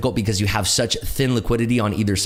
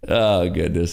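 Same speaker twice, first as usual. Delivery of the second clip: ordinary delivery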